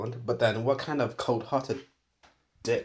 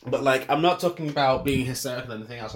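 usual speaking voice

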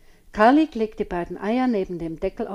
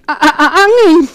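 can't get it out for laughing; boy fibbing and cracking up with giggles; giggling stutter; 8 semitones higher, 16 dB louder than usual. A narrator's reading. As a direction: stammer the word through giggles. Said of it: "vor Kichern gar nicht richtig herausbringen".

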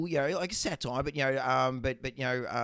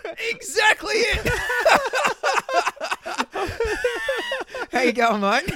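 much higher, louder, whooping exclamation into a laugh; excited shout; 8 dB louder than usual. Real, not acted. Greeting his co-host, a joyful high shout. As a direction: yell it out with delight, laughing.

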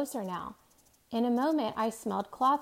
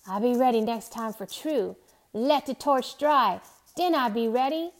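put-on Caribbean accent; 2 semitones higher, 6 dB louder than usual. A narrator's reading. Caribbean old sailor voice.

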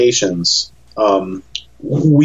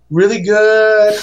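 high, drawn-out, blissfully agreeable reply; acting out being stuffed into a happy stupor; food drunk; 8 semitones higher, slower than usual. Food-drunk husband answering a friendly saleslady, high and slow. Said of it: "I'm basically food drunk".

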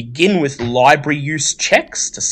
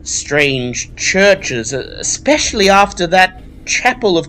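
high, louder, softened librarian voice; friendlier librarian; 6 semitones higher, 3 dB louder than usual. Acted. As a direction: thaw a little, apologise grudgingly. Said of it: "She says".

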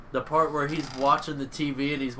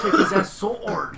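put-on Dutch accent; 8 semitones higher, 5 dB louder than usual. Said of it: "that Dutch accent".

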